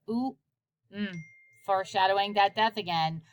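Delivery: ordinary delivery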